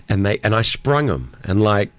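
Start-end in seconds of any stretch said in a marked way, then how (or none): none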